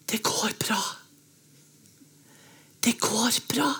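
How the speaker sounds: hushed, intimate whisper. A narrator's reading. Home hugs the narrator so only they hear, whispering. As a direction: whisper it for one listener only. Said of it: "klemmer meg og hvisker".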